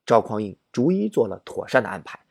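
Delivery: normal talking voice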